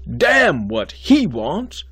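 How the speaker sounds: slow, loud, high contempt; angry. Acted. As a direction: spit each word out. Dean berates student.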